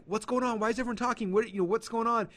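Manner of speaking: high, panicky mock alarm; freaking out. Group members confused by the posts, high panicked mock alarm. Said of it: "they're freaking out".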